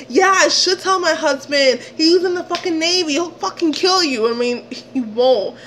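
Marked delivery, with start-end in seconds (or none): 0.1-4.3 high, indignant retold warning; heated threat. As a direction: threaten him in a raised pitch.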